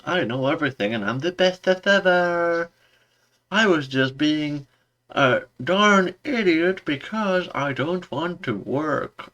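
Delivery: high mocking whine trailing into blah blah; mocking Mariner. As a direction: ham up Mariner as a smug know-it-all. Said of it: "Mariner is like".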